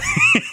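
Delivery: sudden very high laugh; squeaky high laugh; real laugh. Real, not acted. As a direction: burst out laughing. Laughing at the twist.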